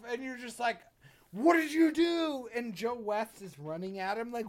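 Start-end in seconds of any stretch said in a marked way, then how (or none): 1.3-2.5 high, loud horrified question; shocked outcry. Retold or imitated. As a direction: cry out as a stunned viewer. Acts viewer's shocked cry.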